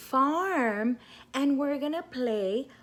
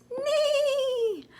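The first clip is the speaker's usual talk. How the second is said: high, playful short neigh; whinny goodbye; high short horse call; 13 semitones higher than usual. Shown as a demonstration. Horse sound saying bye.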